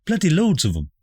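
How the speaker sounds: lightly voiced chatty mother; posh mother voice.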